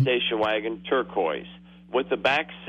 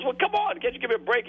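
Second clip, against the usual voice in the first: much higher, whiny plea; begging teen; 10 semitones higher, faster than usual. Retold or imitated. Teen begging the examiner for a break, high and whiny. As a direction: wheedle for a second chance.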